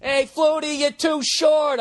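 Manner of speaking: high, drawn-out heckler's yell; high-pitched heckling, stretched out; mock yell. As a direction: imitate a stadium crowd jeering from afar. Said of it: "They yell".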